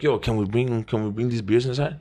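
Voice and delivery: soft, timid ask; meek question. Retold or imitated. Teen quietly asking the doorman about the beer. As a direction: ask like a nervous kid. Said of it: "I asked him".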